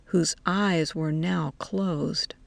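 ordinary delivery

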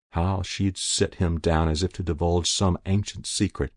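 plain reading voice

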